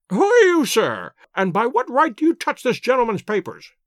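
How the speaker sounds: high, loud, crackling demand; sharp crackling old man. A narrator's reading. Old lord challenging Holmes over papers, high crackling demand. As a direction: snap at the intruder like an affronted old lord. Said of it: "his voice had a sharp crackle".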